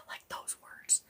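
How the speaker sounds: breathy whisper under the breath; whispered jokey aside. Real, not acted. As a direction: drop to a secret whisper. Aside about fancy words.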